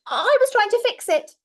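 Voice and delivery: put-on high, loud little-boy voice; Finn's fib. Little boy lying to Grandma, high and loud. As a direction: blurt it out like a guilty child.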